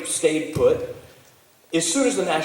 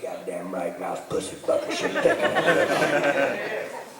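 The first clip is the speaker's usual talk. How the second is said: granddaddy agreeing; slower than usual. Retold or imitated. Granddaddy backing up his cussing grandson, slow retold approval. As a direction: echo it slowly as the approving old man, breaking into laughter. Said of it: "He's like".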